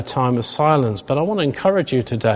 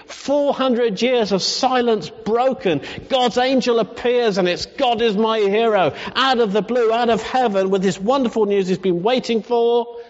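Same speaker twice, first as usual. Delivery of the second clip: excited build; 7 semitones higher than usual. Acted. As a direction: lift it up with joy. Builds excitement over the news.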